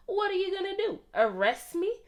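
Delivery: sassy. A narrator's reading. Dog defends chewing shoes.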